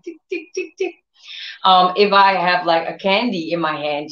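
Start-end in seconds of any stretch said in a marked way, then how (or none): 0.0-1.0 tiptoe ticks. Retold or imitated. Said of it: "she comes, like, tiptoeing".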